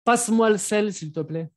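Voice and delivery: teacher demonstrating an order; giving an order. Shown as a demonstration. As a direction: snap it out as a command at the dinner table. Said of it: "pero ahí es un orden".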